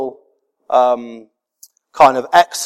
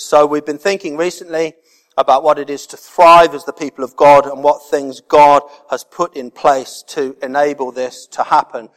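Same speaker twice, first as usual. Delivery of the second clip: ordinary delivery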